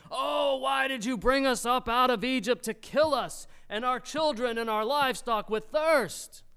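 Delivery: high, loud, aggrieved outcry; loud grumbling. Acted. Thirsty people accuse Moses, loud and grumbling. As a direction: complain at full volume like an angry mob. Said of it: "the people grumbled against Moses".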